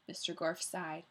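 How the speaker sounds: ordinary delivery, nothing marked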